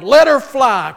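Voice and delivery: loud, high call from the pews; yelled with greater gusto. Retold or imitated. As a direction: shout it with all the gusto you have. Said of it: "with even greater gusto, someone yelled".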